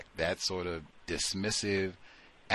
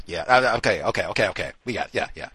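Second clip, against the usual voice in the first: usual speaking voice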